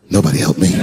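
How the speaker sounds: lightly voiced petty mutter; mock muttered Chris Rock. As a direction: sulk under your breath. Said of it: "Chris was backstage looking like".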